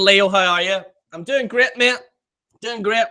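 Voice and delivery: usual speaking voice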